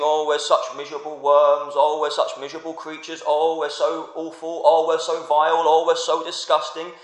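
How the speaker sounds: hammed-up wailing of self-abasement; mock worm theology prayer. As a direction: parody a grovelling prayer meeting. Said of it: "in the prayer meeting".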